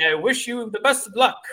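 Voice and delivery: put-on high old-timey ballplayer voice; lightly voiced 1930s ballplayer; old-timey thirties voice. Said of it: "my old-timey thirties voice".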